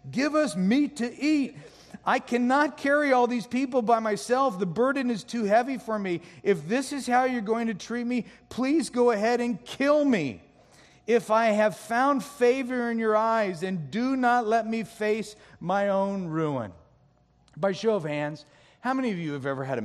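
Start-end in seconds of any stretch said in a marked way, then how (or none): 0.1-1.5 wailing crowd. Acted. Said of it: "They keep wailing to me". 2.0-16.7 higher, louder desperate pleading; Moses meltdown. Acted. Moses crying out to the Lord in a loud meltdown. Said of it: "There's a Moses meltdown".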